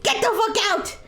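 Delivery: high mock furious yell; mock angry shout. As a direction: shout them out. Character losing patience.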